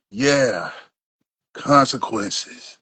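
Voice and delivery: low, slow movie tough-guy line; John Wick. Acted. Co-host quoting an action hero on paying the price, low and slow. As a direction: draw it out low and measured. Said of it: "Like my boy John Wick said".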